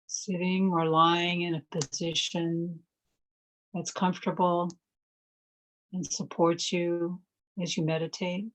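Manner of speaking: calm, measured phrases with long silences between; guided meditation. Real, not acted. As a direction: guide listeners gently into stillness, leaving space to breathe.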